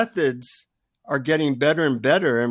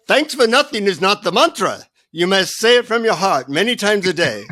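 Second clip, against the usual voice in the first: put-on high, insistent Indian-accented correction; comic guru lecturing in an Indian accent; 5 semitones higher, 3 dB louder than usual. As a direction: admonish a pupil as an Indian guru. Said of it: "in his Indian accent".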